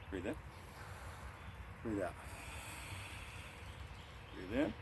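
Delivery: soft, even breathing cues; guided breathing. Shown as a demonstration. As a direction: count the breath calmly for the class. Leads repeated breathing cycle.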